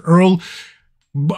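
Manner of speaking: ordinary talk, nothing marked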